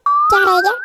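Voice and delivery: sudden high startled question. Acted. Surprised by the question, a sudden high startled reply. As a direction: jump up in pitch, caught off guard.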